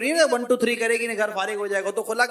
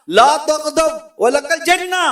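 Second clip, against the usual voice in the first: slow, louder solemn Arabic recitation; 5 semitones higher, 7 dB louder, slower than usual. Real, not acted. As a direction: intone the sacred words. Quoting hadith in Arabic.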